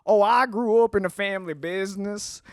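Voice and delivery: put-on Southern backstory line; Southern accent. Shown as a demonstration. Guest showing the bit he does, Southern accent. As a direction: play the Southerner telling his origins. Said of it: "So then I'll just do like".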